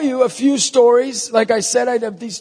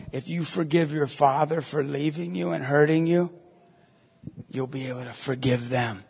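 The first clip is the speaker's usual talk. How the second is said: low, hushed counsel; gentle, sincere; 8 semitones lower, 9 dB quieter than usual. Retold or imitated. Priest to a bullied stranger about forgiving a father, softly sincere. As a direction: console softly, one to one.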